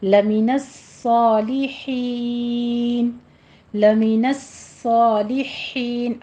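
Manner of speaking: very slow recitation of الصالحین with the ص hissed and its shadda held; tajweed demo. Shown as a demonstration. Teacher models the whistled صاد for students, very slowly. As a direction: draw out لمن الصالحین, the ص sharp and doubled. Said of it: "سیٹی کی آواز بھی ہے اور سختی بھی کرنی ہے".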